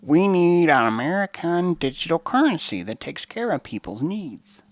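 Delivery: a hint of a sugary stump speech; Elizabeth Warren impression. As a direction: parody a politician drawing out a promise. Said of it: "Elizabeth Warren tells you".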